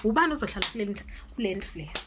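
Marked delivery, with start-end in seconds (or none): none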